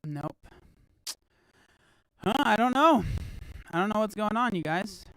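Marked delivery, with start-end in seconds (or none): 2.2-5.0 frustrated outburst. Real, not acted. Stream audio failing, frustrated.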